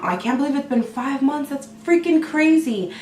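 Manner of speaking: ordinary delivery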